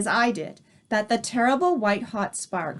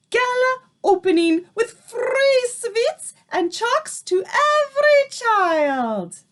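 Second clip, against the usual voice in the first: put-on much higher, louder accented witch commands; Grand High Witch shouting orders to her coven; 11 semitones higher, 5 dB louder than usual. A narrator's reading. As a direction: announce the plan with wicked triumph.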